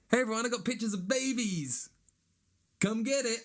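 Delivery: high, loud, gushing mock parent voice; mock proud parent. Comedian mocking a parent who posts baby photos, high gushing voice.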